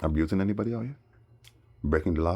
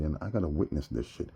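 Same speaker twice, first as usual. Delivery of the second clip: ordinary talk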